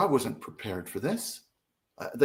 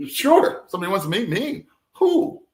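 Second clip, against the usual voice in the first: amazed; 8 semitones higher, 4 dB louder than usual. Retold or imitated. Joking amazed reply.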